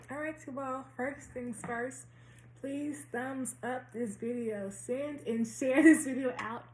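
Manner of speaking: slow speech with a retainer; drawn out around a retainer; wearing a retainer. Real, not acted. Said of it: "I'll be talking slow".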